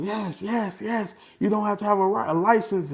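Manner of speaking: high eager juror voice; mock eager jurors. Retelling how the jurors agreed with him, high and eager. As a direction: chirp it like a happy juror.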